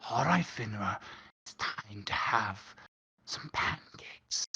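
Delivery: hushed whisper to the dog. Acted. Charles whispering pancake plans to his dog Finra. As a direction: whisper it softly, just for the dog.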